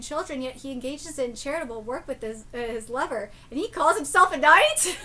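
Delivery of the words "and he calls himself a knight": much louder, mocking disbelief; loud incredulous jeer before a laugh; scoffing. Real, not acted. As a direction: sneer at the so-called knight. Scoffing at the knight's hypocrisy.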